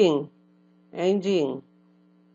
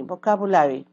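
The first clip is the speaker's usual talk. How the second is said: loud, clear model word for class to repeat; 2 semitones higher, 7 dB louder than usual. Shown as a demonstration. Teacher models a word for students to repeat, loud and clear. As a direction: say it out for the class to echo. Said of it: "repeat after me".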